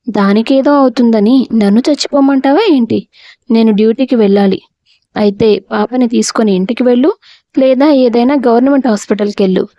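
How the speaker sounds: ordinary delivery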